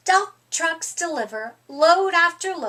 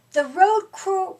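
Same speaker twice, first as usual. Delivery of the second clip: plain reading voice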